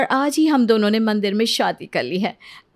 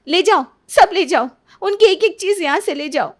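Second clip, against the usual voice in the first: higher and louder bitter dismissal; bitter outburst; 6 semitones higher, 4 dB louder than usual. Acted. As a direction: fling the words at her. Wife bitterly gives up husband's things. (कड़वाहट से चिल्लाती पत्नी)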